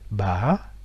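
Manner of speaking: ba qui monte, descend, remonte; ba en trois temps; question tone. Shown as a demonstration. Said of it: "avec un accent interrogatif". (ba avec accent interrogatif)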